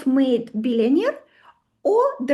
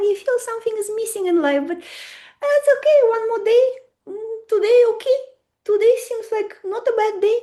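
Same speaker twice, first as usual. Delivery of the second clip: lazy contented client; 8 semitones higher than usual. Retold or imitated. Voicing a complacent client.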